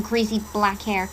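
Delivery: plain reading voice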